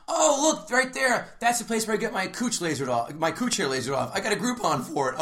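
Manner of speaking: put-on high, bubbly girl voice; chatty coworker voice. Retelling a new coworker pointing out her laser salon, in a high bubbly voice. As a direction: mimic an oversharing coworker, pitched high.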